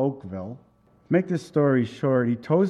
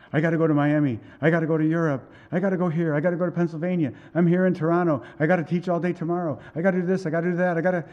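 rapid, higher-pitched list of obligations; imitating someone overwhelmed; 4 semitones higher, much faster than usual. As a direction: rattle off duties as if swamped. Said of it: "I'm just overwhelmed".

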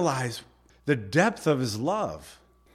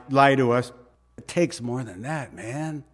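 usual speaking voice

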